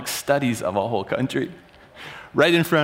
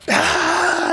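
mock outcry; 12 semitones higher than usual. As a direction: burst out in protest. Room erupts at the claim.

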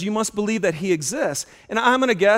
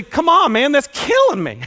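high, louder frustrated outburst; exasperated plea; 7 semitones higher, 3 dB louder than usual. Retold or imitated. Patient venting at a clueless doctor, exasperated. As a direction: vent at the doctor. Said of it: "you almost wanna just say".

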